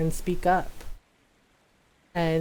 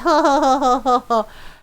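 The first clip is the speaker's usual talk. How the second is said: hearty ho-ho laugh; 6 semitones higher, 5 dB louder than usual. Real, not acted.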